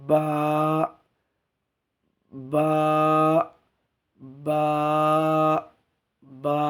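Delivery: বা from both lips, held long; drawn-out, level বা, made at the lips; lips-together articulation. Shown as a demonstration. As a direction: press the lips and release a sustained বা. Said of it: "এইভাবে উচ্চারণ করতে হবে".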